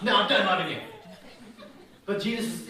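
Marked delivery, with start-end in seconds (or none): 0.0-0.8 a louder, joking fed-up refusal; louder, done with the question, half-joking. Retold or imitated. Husband asked three times, louder mock refusal. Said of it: "That'd be my response".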